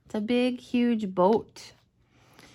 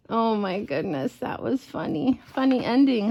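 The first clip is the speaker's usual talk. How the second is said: ordinary delivery, nothing marked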